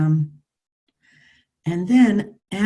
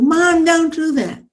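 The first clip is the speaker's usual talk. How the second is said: put-on higher, louder, slow child's yell at a parent; child shouting; 9 semitones higher, 6 dB louder than usual. Child shouting at mom, a loud high yell. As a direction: yell it like a fed-up kid. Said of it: "start shouting at you".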